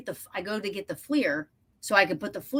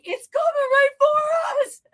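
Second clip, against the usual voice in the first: much higher, slow, crying-out panic; terrified cry; 3 dB louder than usual. Retold or imitated. Telling the co-host it charged them, a terrified cry. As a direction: wail it as it comes at you.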